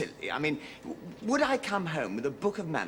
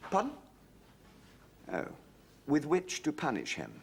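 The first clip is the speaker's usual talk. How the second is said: ordinary delivery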